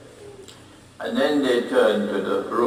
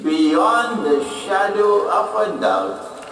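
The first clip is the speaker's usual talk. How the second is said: high, slow, triumphant declaration; acted boast in a raised, emphatic voice; 7 semitones higher than usual. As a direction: proclaim it slowly as proof beyond question. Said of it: "They're boasting now".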